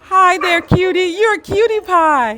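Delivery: talking to a dog. Real, not acted. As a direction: coo at a cute puppy. Cooing at a dog.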